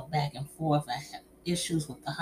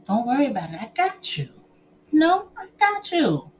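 high, loud mock reassurance; imitating reassurance; 10 semitones higher, 5 dB louder than usual. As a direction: squeal out the promise like a sweet reply. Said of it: "it was just like".

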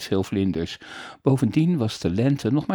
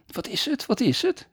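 put-on much higher, eager child's cry; excited cry; 13 semitones higher than usual. Boy begs his father to reveal the invention, much higher and eager. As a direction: bounce with curiosity. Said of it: "riep Frederik".

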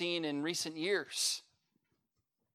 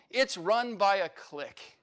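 raised, drawn-out complaint; mock holler; 5 semitones higher, 6 dB louder than usual. Poem's grumbler accuses the church, slow mock holler. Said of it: "then stand up and holler".

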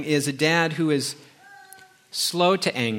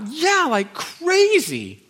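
put-on high, slow, stretched teen reply; son's voice; 5 dB louder, much slower than usual. Son telling dad about tests, high stretched teen voice. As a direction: drag it out like a stressed kid.

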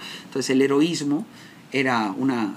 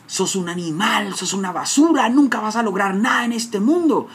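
father's insults; 7 semitones higher, 4 dB louder than usual. Retold or imitated. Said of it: "la programación que mi papá".

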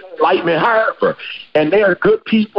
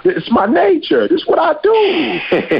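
higher and slow, matter-of-fact shrug; drawn out like it can't help itself; scorpion's excuse; 12 semitones higher, slower than usual. Acted. Scorpion answering the dying turtle, slow and high.

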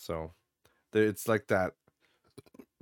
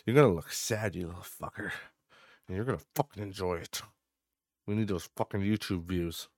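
mock menacing dad.

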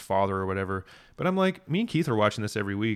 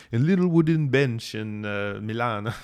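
imitated Italian accent; slower than usual. Reciting her memorized monologue to a co-host, imitated Italian accent. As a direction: mimic an actress's weird Italian accent. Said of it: "it's a weird accent".

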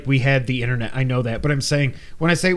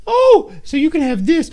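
high sweet mom voice, pitched way up; man doing a woman's voice; 13 semitones higher, 4 dB louder than usual. Retold or imitated. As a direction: mimic a doting mother, much higher. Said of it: "no one knows that I'm making fun".

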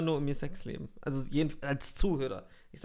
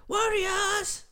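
hoch und laut herausrufen; rufend; 4 dB louder, much slower than usual. Retold or imitated. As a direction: wie ein Gangchef aus dem Auto rufen. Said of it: "fährt einer vor und schreit". (lauter Ruf)

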